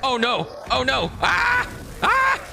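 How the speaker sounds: high panicked shrieks; scared screaming. Real, not acted. Monster attacks streamer's character.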